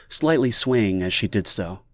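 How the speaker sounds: ordinary delivery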